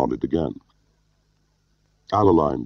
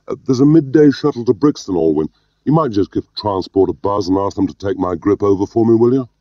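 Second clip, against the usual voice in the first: raised, higher voice; calling out; 6 semitones higher, 5 dB louder than usual. A narrator's reading. Gwilym calls back to Alwyn at the door, raised voice. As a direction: casually call an order across the room.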